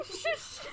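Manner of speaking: high-pitched drawn shush. Acted. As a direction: hush him like a baby. Covering a babbling character's mouth.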